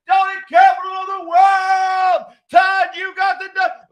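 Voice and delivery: high, loud cheering shout; crowd shouting. Retold or imitated. Townspeople cheering the truck, shouting. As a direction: yell it like the crowd on the street.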